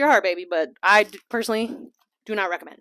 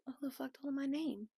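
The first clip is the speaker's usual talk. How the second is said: muttered inner thought; 4 semitones higher, 17 dB quieter than usual. Retold or imitated. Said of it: "and I'd be like".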